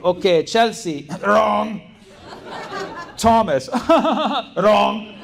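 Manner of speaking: high, laughing mock professor; professor grading. Professor shaming students in front of class, laughing mock voice. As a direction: call out each wrong answer with amusement. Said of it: "So he was like".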